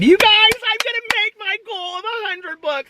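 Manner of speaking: very high, thrilled squeal; excited squeal. Real, not acted. Vlogger telling viewers about reaching a reading goal, excited squeal. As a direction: squeal it out, overjoyed.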